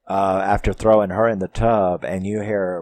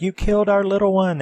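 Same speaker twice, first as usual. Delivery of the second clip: high, fast, shrill accusation; grieving mom; 11 semitones higher, faster than usual. Retold or imitated. Grieving mom accusing the girl, high and fast. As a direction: hurl the blame at her. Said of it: "the mom is like".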